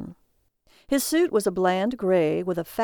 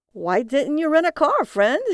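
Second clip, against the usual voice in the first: high, loud prying cabbie; 7 semitones higher, 5 dB louder than usual. Acted. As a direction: needle him with the question. Cabbie voiced in story.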